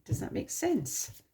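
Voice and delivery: normal talking voice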